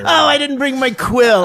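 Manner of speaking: high, fussy olde voice; mock fussy fairgoer. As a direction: play a flustered medieval patron. Acted fairgoer.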